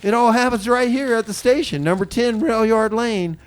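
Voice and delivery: ordinary talk, nothing marked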